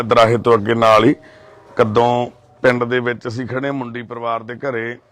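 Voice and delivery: ordinary talk, nothing marked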